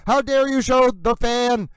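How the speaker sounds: slow, indignant mock scolding; hammed-up offended anchor; mock outrage. Anchor scolding the camera operator over the fan, mock indignant.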